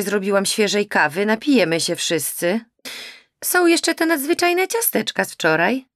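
chirpy mother coaxing her daughter; fussing mother. Acted. Mother calls her daughter to coffee, bustling.

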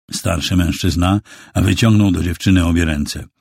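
ordinary delivery, nothing marked

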